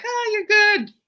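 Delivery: very high, playful "just kidding"; teacher voicing soldiers joking with Tonio, very high and playful; teasing soldiers. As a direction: tease him like a jokey soldier. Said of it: "they're like".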